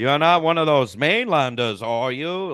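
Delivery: lightly voiced Maine accent; a hint of a Maine islander. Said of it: "the main accent".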